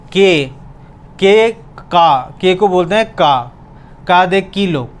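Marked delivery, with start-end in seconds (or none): none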